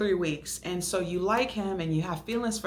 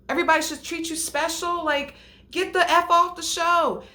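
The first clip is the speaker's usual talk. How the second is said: loud, high, exasperated outburst; going off in disbelief; fired-up yelling at the TV contestant; heated rant; 6 semitones higher, 6 dB louder than usual. Real, not acted. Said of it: "I don't know why I'm getting so turned up".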